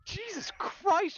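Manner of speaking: drawn-out, high-pitched dismay; appalled exclamation. Real, not acted. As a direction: stretch it out in high disbelief. Appalled at the dark plot turn.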